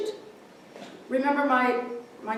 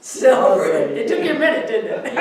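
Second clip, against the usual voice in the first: laughing; 3 semitones higher, 10 dB louder than usual. Real, not acted. Laughing at own joke punchline.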